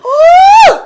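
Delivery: high, loud "woo!"; sustained high-pitched cry of exhilaration; loud whoop. Real, not acted. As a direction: let out a big piercing whoop. Exclaims after heartfelt discussion.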